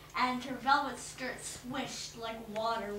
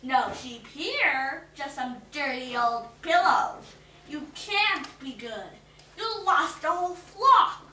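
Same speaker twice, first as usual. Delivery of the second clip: taunting witch; 2 semitones higher, 10 dB louder than usual. A narrator's reading.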